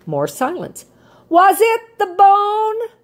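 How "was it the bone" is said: high, loud, slow holler across the yard. A narrator's reading. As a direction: call it out to a bird far away. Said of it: "Rocket hollered".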